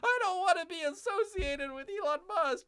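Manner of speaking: high, whiny mock voice with a laugh; mocking Tesla owner. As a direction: imitate a panicky status-seeker. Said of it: "They're like".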